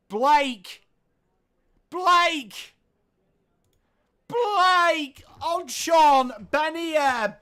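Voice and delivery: excited commentator drawing out the last Blake very slowly; building goal call. Real, not acted. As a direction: call the name again and again as the goal comes on.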